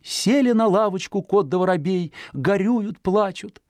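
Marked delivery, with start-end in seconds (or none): none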